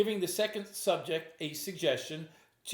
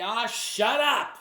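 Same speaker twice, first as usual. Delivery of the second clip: a loud, slow, higher mock yell; imitated yell; 5 semitones higher, 6 dB louder, much slower than usual. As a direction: bark it out like an annoyed heckler. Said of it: "someone else might just yell at me".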